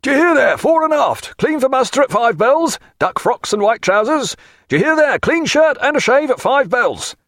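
high, loud crier's bawl; shipboard cry. A narrator's reading. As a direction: bawl it high so it carries through the ship. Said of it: "they heard the cry pass through the ship".

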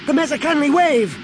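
very high-pitched squealing exclamation; excited squeal. A narrator's reading. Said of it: "Anakin squealed".

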